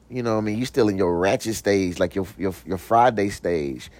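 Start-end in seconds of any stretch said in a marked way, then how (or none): none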